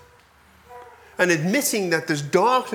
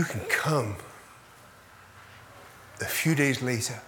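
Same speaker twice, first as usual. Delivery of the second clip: gentle voice of God; 5 semitones lower, 7 dB quieter than usual. Retold or imitated. God voiced speaking to Jones.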